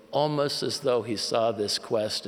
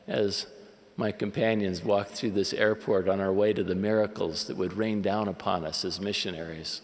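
normal talking voice

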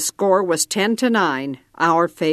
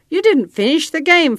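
higher and louder, curt accusation; sharp retort; 9 semitones higher, 5 dB louder than usual. A narrator's reading. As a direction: snap it back at the boy. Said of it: "Flacco snapped".

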